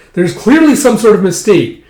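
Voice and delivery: acted urgent denial; alarmed protest. Acted protest over the test.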